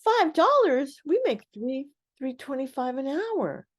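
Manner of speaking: imitating the shock of an underpaid worker; shocked disbelief. Coworkers hearing her five-dollar wage, retold shocked disbelief.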